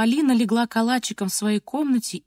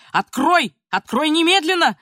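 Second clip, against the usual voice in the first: loud, high command; acted angry shout; grandfather shouting; 6 semitones higher, 6 dB louder than usual. A narrator's reading. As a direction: order it through a locked door. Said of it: "Дедушка кричал".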